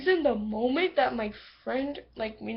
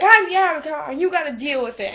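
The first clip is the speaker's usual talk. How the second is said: loud and higher, full of swagger; bold proud declaration; 4 semitones higher, 10 dB louder than usual. Retold or imitated. Girl declaring her Avatar status, loud and cocky. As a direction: boom it out like a boast. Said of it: "she's like".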